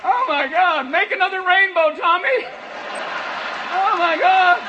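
a firefighter getting high off the smoke; stoned firefighter. Retold or imitated. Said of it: "the guys fighting the fires are like".

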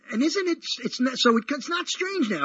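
ordinary talk

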